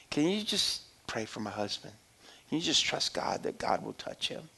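soft, nearly whispered plea; wife quietly begging the doctor to pray; hushed plea. Retold or imitated. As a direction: beg the doctor gently under your breath. Wife pleading with doctor, retold.

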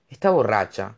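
ordinary delivery, nothing marked

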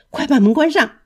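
loud, high order to shut the door; urgent shout. Acted. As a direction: bark the order in fear. 里面的声音急喊. (旅馆里的人惊慌地喊关门)

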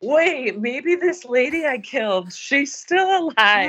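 high-pitched mock surprise, slowing at the end; mock realization. Host mimicking killer fooled by a sling, mock amazement. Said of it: "for him to be like".

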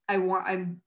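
ordinary talk, nothing marked

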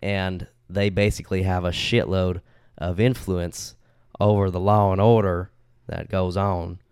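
put-on playful Southern US accent. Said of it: "tell the story in an accent".

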